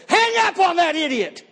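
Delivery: slow, high mock yell at his wife; yelled order. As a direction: bark it across the room, drawn out. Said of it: "I yelled loud enough".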